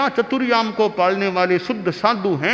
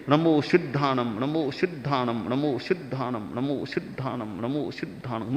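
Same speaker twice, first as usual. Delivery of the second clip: repeating "नमो सिद्धाणम" lower and softer each time until almost silent; fading quiet recitation; 6 semitones lower, 7 dB quieter than usual. Shown as a demonstration. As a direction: murmur the mantra, sinking into the mind. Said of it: "मन ही मन नमस्कार महामंत्र का जप". ("नमो सिद्धाणम" को धीरे-धीरे मन में डूबता हुआ जप)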